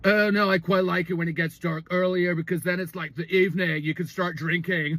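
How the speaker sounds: mock frat-bro drone. Boyfriend answering her about the yoga wall, frat-bro voice. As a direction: imitate an easygoing bro with stretched vowels. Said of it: "like, a sort of a frat bro".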